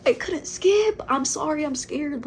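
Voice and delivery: high, loud panicky outburst, easing slightly; panicked. Retold or imitated. Girl unable to skip a threatening stranger, acted panic. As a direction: re-enact a squealed panic, pitch shooting up.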